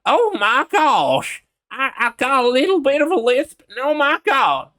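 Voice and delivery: very high, loud southern accent with a lisp; over-the-top southern squeal, lisped. Shown as a demonstration. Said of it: "crank it all the way".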